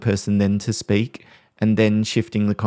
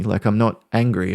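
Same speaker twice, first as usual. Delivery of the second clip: ordinary delivery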